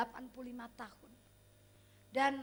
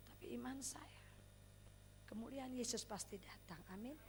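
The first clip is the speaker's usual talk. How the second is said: hushed faith; 4 semitones lower, 12 dB quieter than usual. Real, not acted.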